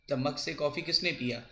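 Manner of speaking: ordinary talk, nothing marked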